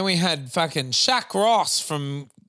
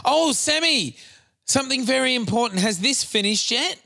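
excited urgent announcement; 7 semitones higher than usual. Real, not acted.

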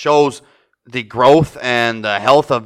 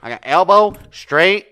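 mock Italian guy, louder; 8 semitones higher, 6 dB louder, slower than usual.

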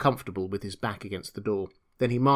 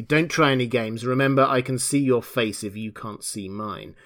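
ordinary delivery

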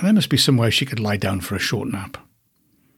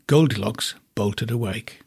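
ordinary delivery

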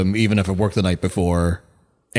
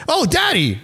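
put-on high little kid voice; toddler voice. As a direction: coo it like a small child. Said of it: "He goes".

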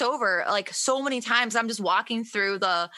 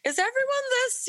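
bewildered. Retold or imitated. Her bewildered thought quoted.

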